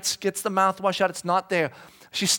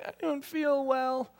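put-on higher, slow little-girl voice; sick toddler; 8 semitones higher, 5 dB quieter, slower than usual. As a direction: say it like a poorly three-year-old. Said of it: "she's like".

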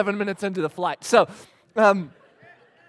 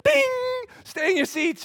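much higher, louder and fast, hammed-up cabin announcement; mock flight attendant; 8 dB louder than usual. Dad mocking the flight attendant's landing call, high chirpy. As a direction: parody the attendant's chirpy warning. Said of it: "they're like".

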